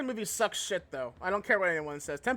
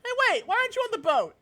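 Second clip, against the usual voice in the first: surprised; 13 semitones higher, 6 dB louder, faster than usual. Real, not acted. Character missing from the boat.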